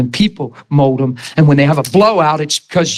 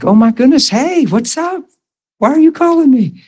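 high, excited surprise at an unexpected call; a bright startled hello, voice jumping up; surprised; 12 semitones higher than usual. Retold or imitated. Surprised greeting to an old coworker on the phone.

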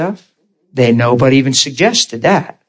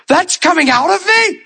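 much higher, aghast question; high horrified squeak; shocked disbelief. Retold or imitated. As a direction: recoil at what you see. Shocked at the bloody bag.

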